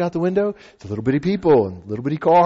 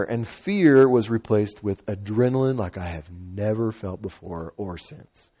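normal talking voice

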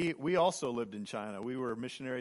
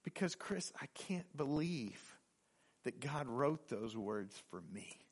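awed, tender; 8 dB quieter than usual. Retold or imitated. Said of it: "And she said".

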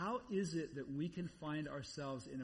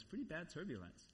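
softer, downplayed answer; understated reply; 7 dB quieter than usual. Husband telling waking wife about the flight, understated.

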